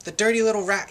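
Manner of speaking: storyteller acting an indignant cry. A narrator's reading. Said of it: "he cried".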